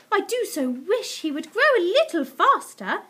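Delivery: high, loud, earnest wishing; wistful pet owner. Acted. Missus Silver fretting over Alfie's growth, high earnest wishing. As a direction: wish it fervently in a high voice.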